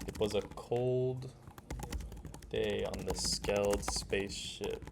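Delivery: slow, soft, suspenseful reading with pauses; dramatic story reading. Shown as a demonstration. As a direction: read it like a spooky tale, slowly. Reading the dramatic reference script.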